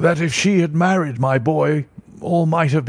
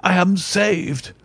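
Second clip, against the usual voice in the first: higher, slow cry of relief; joyful relief; 6 semitones higher, slower than usual. Acted. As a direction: let the relief burst out. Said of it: "With a shriek of joy".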